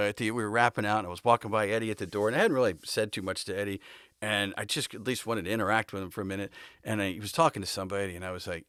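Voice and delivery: ordinary talk, nothing marked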